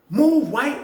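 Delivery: a guard-like snapped command; retold bark. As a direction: mimic the lady barking him off the bunk. Lady ordering him off bunk.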